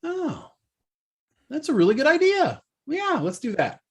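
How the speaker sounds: mock agreeable voice. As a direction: chirp it like an eager yes-woman. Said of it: "what if she was just like".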